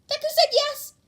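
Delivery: very high, loud squeal; squealing excitement. Acted. Toru telling the chat Deku agreed, squealing. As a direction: shriek the good news.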